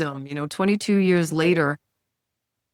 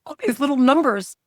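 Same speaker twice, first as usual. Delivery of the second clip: playful giddy gush, pitched a bit higher; 6 semitones higher than usual. Retold or imitated. Coach gushing over her cheap program prices, lightly giddy. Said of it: "I get so giddy. I'm like".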